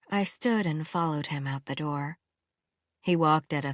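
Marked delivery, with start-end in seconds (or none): none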